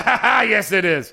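loud, high gloating cry with a laugh; laughing, loud retold gotcha; triumphant comeback. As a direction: crow it in victory.

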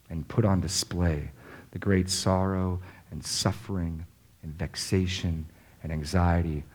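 normal talking voice

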